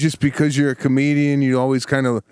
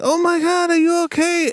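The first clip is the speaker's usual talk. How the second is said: much higher-pitched imitation of a worried woman; alarmed woman. Woman checking on a fallen hiker, alarmed mock voice. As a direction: mimic her high, fretful cry of concern. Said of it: "the woman's like".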